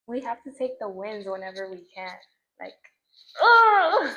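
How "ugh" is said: a loud, high, overwhelmed "ugh!"; loud high sigh-groan of delight; blissful ugh. Real, not acted. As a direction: let out a big high groan of pure contentment. Blissed-out exclamation.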